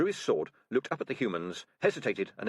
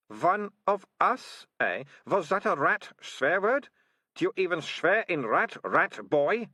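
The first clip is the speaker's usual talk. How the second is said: mocking taunt; 9 semitones higher, 5 dB louder, slower than usual. A narrator's reading. Militia mocking Keith.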